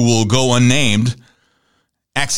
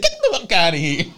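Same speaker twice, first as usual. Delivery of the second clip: laughing quote; 5 semitones higher than usual. Retold or imitated. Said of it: "He just said".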